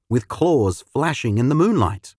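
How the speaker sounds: ordinary delivery, nothing marked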